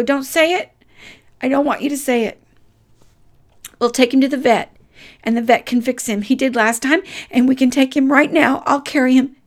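tearful pleading. Retold or imitated. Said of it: "And I was sobbing".

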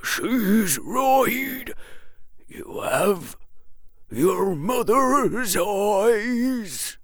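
put-on high old man's quaver, drawn out at the end. A narrator's reading. Old man speaking of the boy's mother, quavering. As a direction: stretch the words in an elderly quaver. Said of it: "quavered the old man".

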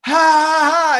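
loud, high mock ha ha; mocking laugh. Bam mocking the principal with a loud, high ha ha. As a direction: laugh right in his face. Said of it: "he just goes".

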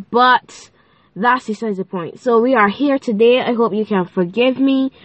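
usual speaking voice

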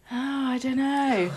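high, soft, far-off mumble of Todd; mock evasive Todd. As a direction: hand back a shrugging non-answer. Mocking Todd's evasive answer.

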